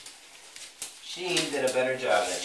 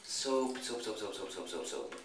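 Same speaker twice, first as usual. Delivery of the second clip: fading mutter; 3 semitones lower, 9 dB quieter than usual. Real, not acted. Reaching for the soap, fading.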